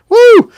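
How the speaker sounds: high, loud "woo"; excited whoop. Real, not acted. Host thrilled about the knife design, excited whoop. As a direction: let out a sudden high whoop.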